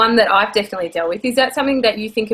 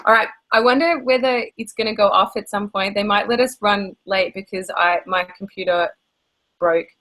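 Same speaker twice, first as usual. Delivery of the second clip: ordinary delivery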